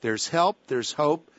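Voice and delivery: ordinary talk, nothing marked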